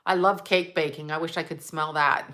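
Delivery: normal talking voice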